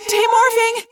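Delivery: very high, loud cry; frantic yell. A narrator's reading. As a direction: yell it in a panic. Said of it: "Cassie yelled".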